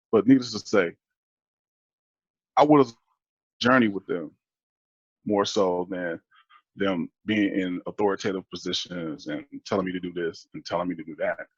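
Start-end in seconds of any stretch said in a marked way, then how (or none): none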